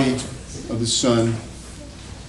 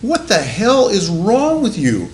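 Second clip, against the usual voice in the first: mock outraged wife; 8 semitones higher, 5 dB louder, slower than usual. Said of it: "she goes".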